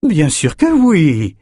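un « Bien sûr que oui! » plus aigu, plus fort et lent; s'exclamer avec conviction; exclamation appuyée. Acted. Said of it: "s'exclama Victoria". (Victoria qui s'exclame, sûre d'elle)

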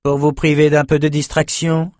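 grating retort. Acted. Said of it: "grinça Nietzsche".

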